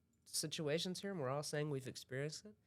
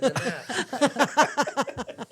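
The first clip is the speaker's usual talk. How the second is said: slow, loud laughing line; 6 semitones higher, 14 dB louder, much slower than usual. Acted. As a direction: draw it out through laughter.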